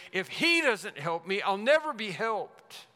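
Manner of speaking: desperate plea. Acted. Preacher voicing someone who hears of Jesus, desperate. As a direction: plead urgently, leaning hard on the words. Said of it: "Bartimaeus is desperate".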